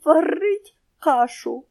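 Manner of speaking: slow, high squawky parrot, almost crying; a lost parrot calling out, near tears; tearful parrot voice. A narrator's reading. Lost parrot calls the wake-up words, almost crying. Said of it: "і мало не плачучи".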